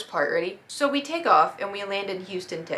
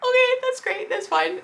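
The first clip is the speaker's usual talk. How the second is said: very high, strained falsetto; mock-cheerful falsetto cover-up; falsely okay squeak. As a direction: pretend it's fine in a tiny high voice. Pretending lost bag is fine.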